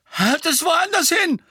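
very high, loud squeal; frightened shout; panicked. A narrator's reading. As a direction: squeal in alarm to get it away. Frightened bully at wand point.

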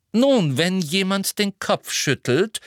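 slow, high, icy explanation; cold. A narrator's reading. McGonagall explains the obvious to Umbridge, coldly. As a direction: spell it out with cold disdain. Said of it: "sagte McGonagall kalt".

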